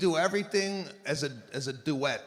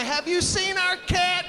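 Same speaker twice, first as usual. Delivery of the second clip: much higher, loud neighbor calling out; high worried neighbor; 13 semitones higher, 5 dB louder than usual. Retold or imitated. Neighbor asking about the lost cat, high and loud.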